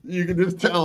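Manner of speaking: normal talking voice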